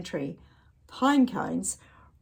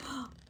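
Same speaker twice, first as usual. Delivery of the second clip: gasp; 5 semitones higher, 14 dB quieter than usual. Real, not acted. Tightrope falls.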